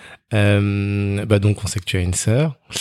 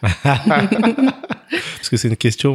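laughing; 6 semitones higher, much slower than usual. Real, not acted. Laughing after guest's joke.

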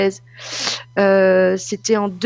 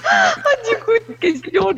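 laughing squeal; 12 semitones higher than usual. Real, not acted. Laughing after troll voice.

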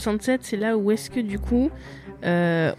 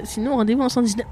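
ordinary talk, nothing marked

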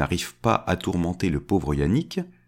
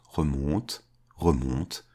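softer and lower, as if receding; fading away; 5 semitones lower, 5 dB quieter, much slower than usual. A narrator's reading. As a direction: let it drift off into the distance. Climbing up, fading into distance.

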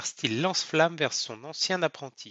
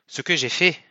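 angry retort, somewhat louder; spat in rage; 5 dB louder than usual. A narrator's reading. Captain answers the accusation, spitting with rage. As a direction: spit the question back in fury. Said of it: "Olsen cracha de rage".

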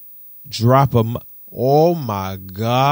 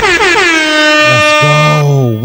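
hype shout; 9 semitones higher, 12 dB louder than usual. Real, not acted. Hyping hometown pizza win.

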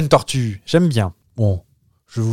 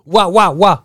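high loud woof woof; dog barking; 7 semitones higher, 6 dB louder, much slower than usual. Retold or imitated. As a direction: bark like a chained dog. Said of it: "Qui font le chien".

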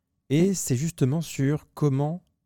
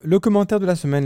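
raised, louder jingle-style announcement of the segment; 8 semitones higher, 5 dB louder than usual. Acted. Host to listeners, introducing the comment of the week, announcer voice.

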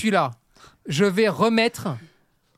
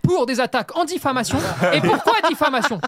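higher, hammed-up indignation; mock outrage; 4 semitones higher, 4 dB louder than usual. Host mock-threatening listeners with lawsuits, indignant. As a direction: play the offended man of honour.

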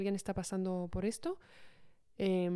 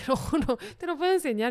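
voz aguda y fuerte, divertida; juramento entre risas; 6 semitones higher, 6 dB louder, faster than usual. Real, not acted. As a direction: insiste riendo para que te crean. Jura entre risas ante la incredulidad. (jurándolo entre risas)

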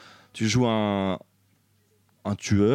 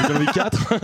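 imitation légère; 5 semitones higher, slower than usual. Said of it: "Je le fais bien ou pas?".

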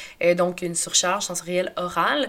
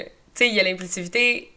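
ordinary delivery

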